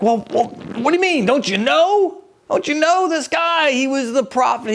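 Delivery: incredulous disciples. Pretend. Disciples answer in disbelief.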